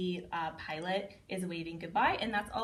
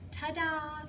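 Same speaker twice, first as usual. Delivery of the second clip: bunny ta-da; 8 semitones higher than usual. Shown as a demonstration. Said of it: "the little bunny's going".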